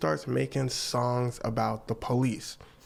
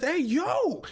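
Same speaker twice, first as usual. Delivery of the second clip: shocked homeowner. Retold or imitated. Homeowner voiced in story.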